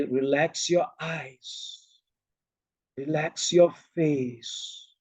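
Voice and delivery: slow, soft meditation voice; guided relaxation. Shown as a demonstration. Teacher telling the group to relax eyes and face, slow and soft. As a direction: soothe them with softened, lengthened words. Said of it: "I will say".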